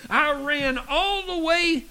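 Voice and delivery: high-pitched, hammed-up breathless boast; mock exhausted husband. Husband boasts to wife of running home, mock exhausted. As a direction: push it out high, as if just back from a run. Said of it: "almost completely exhausted".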